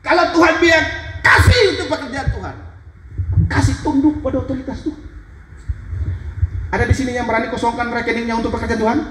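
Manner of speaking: high-pitched pulpit challenge; fervent preaching. Real, not acted. Preacher daring people to empty their accounts, fervent.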